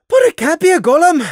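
high, loud frantic protest; acted panicky outburst; exclaiming. A narrator's reading. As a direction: cry it out in alarmed denial. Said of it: "Lily exclaimed".

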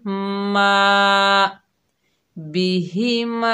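बिहिमा with मा held one alif long; मा lengthened; stretched ma. Shown as a demonstration. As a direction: draw out the final मा. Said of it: "एक अलिफ की मिकदार खींच कर पढ़ेंगे".

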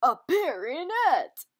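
loud, slow, swooping pun reveal; punchline delivery. Acted. Answering own riddle about a bear, big loud punchline. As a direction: announce the punchline big and drawn out.

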